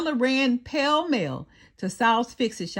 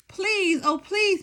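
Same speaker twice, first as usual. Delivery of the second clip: begging; 9 semitones higher than usual. A narrator's reading. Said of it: "begged Stella".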